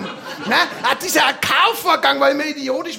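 usual speaking voice

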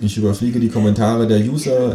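usual speaking voice

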